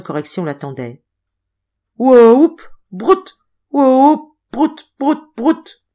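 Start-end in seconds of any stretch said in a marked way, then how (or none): 2.0-5.8 high, loud ape-like yelps; whimpering ape plea. A narrator's reading. Balao at Madeleine's knees begging mercy, high ape cries. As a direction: beg for mercy like a frightened great ape. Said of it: "pleurnichant et geignant".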